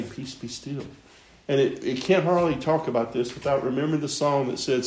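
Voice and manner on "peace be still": very soft, hushed calm command. Acted. Preacher tells how the Lord calms the storm, very softly. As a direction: breathe it out almost silently.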